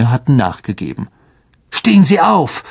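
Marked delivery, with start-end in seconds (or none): 1.7-2.6 sharp command, pitched high. A narrator's reading. Ron ordering the collapsed Lockhart up: sharp command. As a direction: snap the order at him. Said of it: "sagte Ron scharf".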